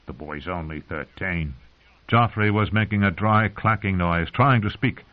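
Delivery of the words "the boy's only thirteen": hushed inner thought. Acted. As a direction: murmur it to yourself.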